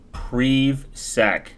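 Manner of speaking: PrivSec as "preev-sack", syllables spaced out; long-e pronunciation. Shown as a demonstration. Streamer working out a name, PrivSec as "preev-sack". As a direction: sound out PrivSec with the vowel of "sleeve". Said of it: "doesn't sound right".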